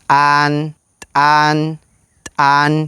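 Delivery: «tan» with the T cut off at the glottis; «tan» with a hard glottal catch before the vowel; glottalized T. Shown as a demonstration. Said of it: "la diferencia con la T glotalizada viene en la tercera palabra". («tan» con t glotalizada)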